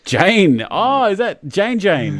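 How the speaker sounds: loud, high, slowed surprise at a name; loud delighted surprise at seeing a viewer; surprised. Real, not acted.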